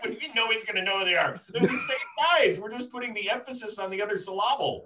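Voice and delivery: hammed-up outrage at the bosses; mock incredulous rant. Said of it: "It's like".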